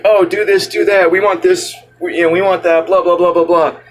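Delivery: high whiny nagging demands; mocking whiny voice. Imitating focus group demands.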